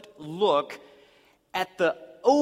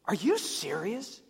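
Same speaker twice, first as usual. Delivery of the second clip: incredulous; 3 semitones higher, 3 dB quieter than usual. Retold or imitated. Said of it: "they've said this question".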